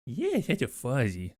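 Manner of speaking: slow, soft cooing; baby talk. Pretend. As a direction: coo it slowly to a pet. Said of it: "baby talking".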